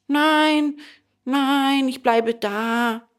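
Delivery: put-on high, drawn-out little boy voice; boy refusing. A narrator's reading. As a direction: refuse in a high child's voice, stretching the words. Boy refuses his friend's urging. (hohe, gedehnte Kinderstimme, ablehnend)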